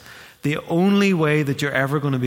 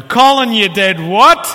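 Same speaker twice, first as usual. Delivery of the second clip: much higher and louder, outraged father; dad's outraged shout; 8 semitones higher, 11 dB louder, slower than usual. Retold or imitated. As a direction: shout the name in disbelief. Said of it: "It ended with my dad going".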